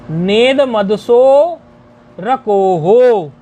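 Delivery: नेद मदसो रकोहो very slow, syllables joined and stretched; metrical pronunciation. Shown as a demonstration. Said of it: "ऐसे इसका उच्चारण है".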